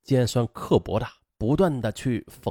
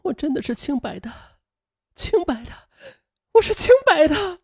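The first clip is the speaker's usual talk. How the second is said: hysterical repeating. Acted. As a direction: insist desperately in a high voice, breaking into a shout. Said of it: "歇斯底里的重复着". (歇斯底里地重复，最后哭喊出来)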